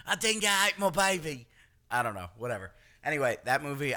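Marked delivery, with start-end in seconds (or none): 0.1-1.5 mock Australian. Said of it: "He's not like".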